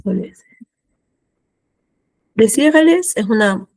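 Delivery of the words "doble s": whisper. Real, not acted. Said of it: "to herself".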